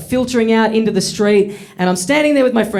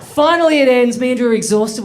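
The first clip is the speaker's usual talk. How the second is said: ordinary delivery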